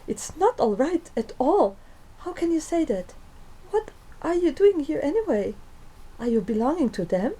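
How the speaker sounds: put-on scared girl squeaking accusations at her captive; squeaky panic. A narrator's reading. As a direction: let the voice climb, near hysterical. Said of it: "she said squeakingly".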